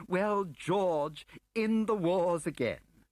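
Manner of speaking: grinning bureaucrat, all false cheer; smug Marston. Acted. Voices Marston.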